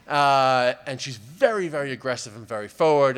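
normal talking voice

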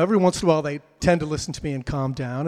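usual speaking voice

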